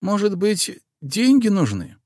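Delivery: put-on high, fussing old housekeeper; an anxious, doting old nanny; old woman voice. A narrator's reading.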